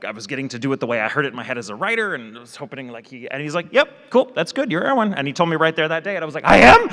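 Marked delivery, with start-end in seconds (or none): 3.7-5.1 casual upbeat director. Retold or imitated. Director casually casting him. 6.5-6.9 high, loud startled "I am?"; stunned disbelief. Retold or imitated. Stunned at being cast.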